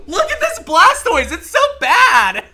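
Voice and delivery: very high, loud, laughing disbelief; exasperated high yell breaking into laughs; laughing shout. Real, not acted. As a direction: shriek it through laughter. Laughing outburst at bad game luck.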